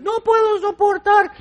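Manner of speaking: high mock whine; whining Moses. Moses complains to God, high mock whine. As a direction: squeal it like he's at the end.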